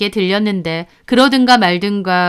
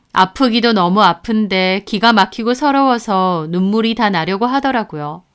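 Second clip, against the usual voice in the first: ordinary delivery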